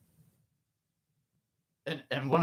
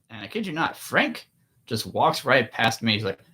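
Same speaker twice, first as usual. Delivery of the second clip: usual speaking voice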